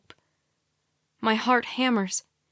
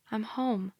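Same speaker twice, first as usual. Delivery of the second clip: plain reading voice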